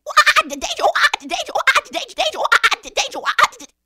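very high, loud monkey shrieks; panicked monkey. Acted. Monkey races across treetops, shrieking alarm. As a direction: jabber the warning in monkey whoops.

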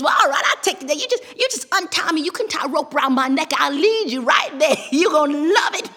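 high, loud, eager rabbit; Brer Rabbit. A narrator's reading. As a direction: coax excitedly, ending in a cackle. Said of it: "Brer Rabbit says".

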